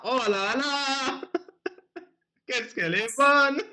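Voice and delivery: ordinary talk, nothing marked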